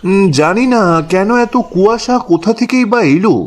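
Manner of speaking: usual speaking voice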